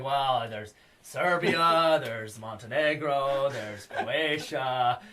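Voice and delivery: very slow, drawn-out list of countries; lightly voiced slow recital; slow listing impression. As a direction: list each country slowly.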